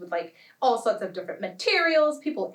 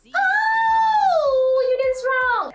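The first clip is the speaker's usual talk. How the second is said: high, loud, long-held holler; acted scream; 8 dB louder, much slower than usual. Protesters screaming from the hilltops, acted high holler. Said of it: "stand on top of the hilltops and scream".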